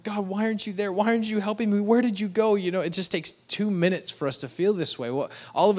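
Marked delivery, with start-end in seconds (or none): 0.0-2.5 higher, rising into a louder cry; anguished plea to God. Retold or imitated. Believer crying out to God, higher, desperate. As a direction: whine at God as if abandoned. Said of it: "we're like".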